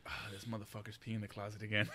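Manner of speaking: low, very soft grumble to self; hushed here-we-go-again aside; weary resignation. Retold or imitated. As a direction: grumble it quietly, fed up. Retold weary thought at friend.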